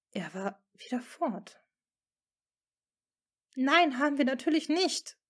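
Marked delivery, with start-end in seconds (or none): 3.5-5.2 high, loud indignant protest. Acted. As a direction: protest as if wrongly accused. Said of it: "gab sie empört zurück".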